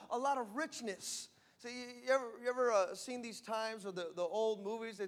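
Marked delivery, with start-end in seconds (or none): none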